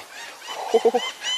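sudden high-pitched giggle. Real, not acted. Cook giggling at his own comment, high laugh. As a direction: let a squeaky laugh slip out.

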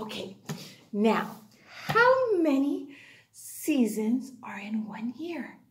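hushed whisper. Acted. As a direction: whisper the question. Whispered question to class.

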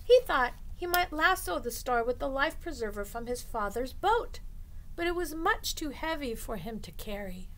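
ordinary delivery

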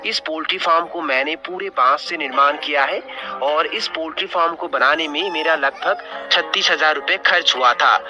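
usual speaking voice

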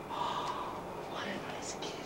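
soft breathy whisper. Real, not acted. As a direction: hush it so the cat stays calm. Hushed beside the cat.

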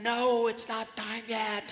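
a lightly acted, drawn-out no; playful refusal. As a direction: hold the eager ones back, slowly. Jesus refusing impatient fish.

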